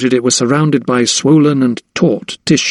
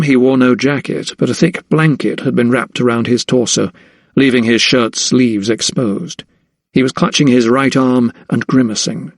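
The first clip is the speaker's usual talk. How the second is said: plain reading voice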